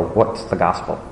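soft, inward question to yourself; thinking in head. Shown as a demonstration. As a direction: ask it quietly, as if inside your head. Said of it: "think in your head".

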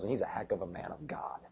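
soft, breathy aside to the crowd; whispered aside. Real, not acted. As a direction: lean in and whisper it. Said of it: "whispered aside".

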